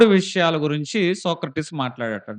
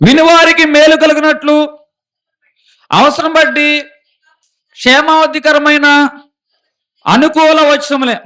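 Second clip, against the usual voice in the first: loud, high, ringing Bible reading; scripture proclaimed; 8 semitones higher, 8 dB louder than usual. Acted. Preacher reading a proverb to the congregation, booming. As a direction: proclaim the verse to the whole church. Reads scripture at full force.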